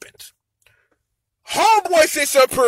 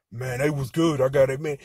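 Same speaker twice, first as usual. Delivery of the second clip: low, softer put-on voice; voiced guest; 10 semitones lower, 7 dB quieter than usual. Guest answering about green room wings, low voiced guest. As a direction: drop deep and quiet for an approving reply.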